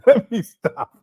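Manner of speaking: can't stop laughing while saying it; cracking up at his own joke. Retold or imitated. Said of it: "He said".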